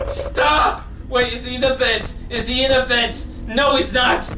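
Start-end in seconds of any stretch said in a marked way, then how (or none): 0.1-0.8 high-pitched real panic, near crying; panicked screaming. Real, not acted. Player begging the scary game to stop, screaming. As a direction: wail and plead in terror. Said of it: "I need to stop screaming".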